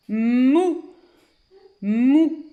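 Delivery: "mou" loud and high as "mmmou"; dictated syllable. Shown as a demonstration. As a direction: dictate "mou" on a humming M, pitch climbing. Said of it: "on dit une syllabe à l'oral". (« mou » dicté, M prolongé)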